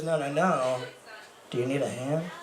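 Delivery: pointed repeat. Retold or imitated. Girl clarifies her one-hand joke, imitated pointed repeat.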